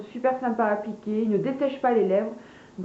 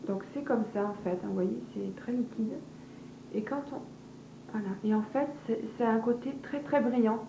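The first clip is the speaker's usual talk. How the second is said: softer, hushed product demo voice; speaking softly; 7 dB quieter than usual. Real, not acted. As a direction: drop to a gentle murmur while applying. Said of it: "je me mets à parler tout doucement".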